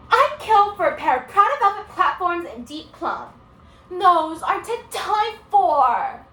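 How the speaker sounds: high, loud, gushing student; unruly student. Acted. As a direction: gush over coveted shoes, slowing at the end. Student answers the teacher's question.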